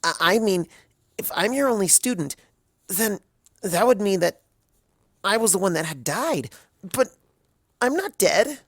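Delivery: a student piecing it together and refusing it; Twilight, rising alarm. A narrator's reading. Twilight realizing her death.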